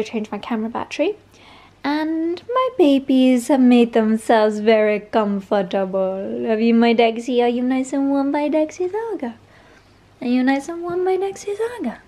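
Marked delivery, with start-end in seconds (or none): 1.9-12.0 sing-song cooing to a beloved dog; doting pet talk. Real, not acted. Cooing to her pet dogs.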